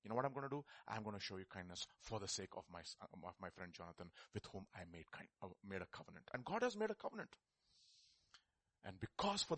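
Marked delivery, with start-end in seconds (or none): 0.0-6.3 soft, low and gentle; calm the frightened man; reassuring David. Retold or imitated. David softly reassuring the fearful Mephibosheth. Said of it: "David says".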